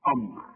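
همزة أمر مفخمة بشكل خاطئ; أمر بهمزة ثقيلة مفخمة; wrong hamza. Shown as a demonstration. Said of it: "تبقى غلط". (نطق خاطئ لهمزة أمر)

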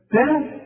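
تاء said high and light, without emphasis; letter contrast. Shown as a demonstration. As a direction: say تاء high and thin, not heavy like طاء. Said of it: "إنما دي تاء". (نطق التاء مرققة عالية)

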